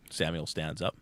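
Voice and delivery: ordinary talk, nothing marked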